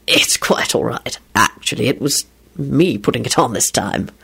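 low, half-whispered reassurance; gentle reassurance. A narrator's reading. Man accepting their apology, low and gentle. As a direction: reassure them, pitched low.

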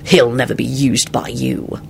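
firm, flat retort; flatly resolute; flat reply. A narrator's reading. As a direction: state it without emotion. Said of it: "Lupin replied flatly".

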